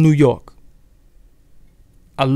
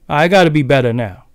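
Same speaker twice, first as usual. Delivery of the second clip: normal talking voice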